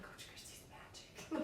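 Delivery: whispered with a laugh in it; awed whisper. Retold or imitated. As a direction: breathe it like a kid in wonder. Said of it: "And they're like".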